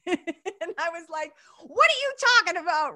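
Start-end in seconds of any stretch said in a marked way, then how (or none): 1.6-3.0 high, loud incredulous outburst; excited disbelief. Retold or imitated. Host retells answering chill Andre, excited disbelief. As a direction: burst out high and loud, unable to calm down.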